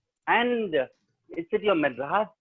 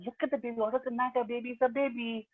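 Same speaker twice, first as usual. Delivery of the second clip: higher, softer nurse voice; a lightly voiced nurse, higher and softer; nurse asking; 7 semitones higher, 7 dB quieter than usual. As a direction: ask it like a fussing nurse. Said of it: "she asked the mother".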